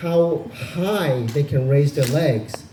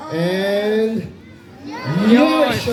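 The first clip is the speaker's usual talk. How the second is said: high, loud chant; repeating louder and sillier; 6 semitones higher, much slower than usual. Shown as a demonstration. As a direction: belt it out louder. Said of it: "One more time, louder".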